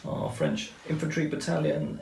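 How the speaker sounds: ordinary delivery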